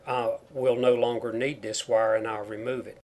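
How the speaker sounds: ordinary talk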